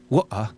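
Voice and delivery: "water" as waw-uh, throat catch for t; glottal T. Shown as a demonstration. Teacher attempting a difficult pronunciation, glottal stop. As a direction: drop both t and r from "water". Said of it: "it's so difficult for me".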